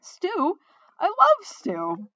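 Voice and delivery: high, excited cry with a slow, loud finish; delighted, high-pitched exclamation; wrong character voice. A narrator's reading. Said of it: "wrong voice, sorry".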